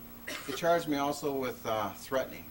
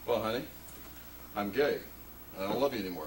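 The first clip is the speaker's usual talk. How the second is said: lower, lightly voiced confession; mock talk-show guest; 8 semitones lower, 3 dB quieter, faster than usual. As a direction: parody a talk-show revelation. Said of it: "they sit in the show and go".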